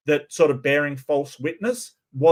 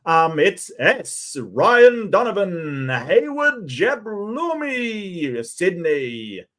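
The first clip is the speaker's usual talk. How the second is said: slow, drawn-out, higher ring announcer call; wrestling commentator; 6 semitones higher, 3 dB louder, much slower than usual. Acted. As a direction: stretch each name like a ring announcer. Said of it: "I'm trying to do the wrestling announcer voice".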